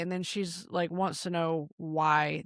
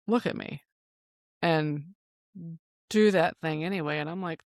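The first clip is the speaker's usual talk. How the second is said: usual speaking voice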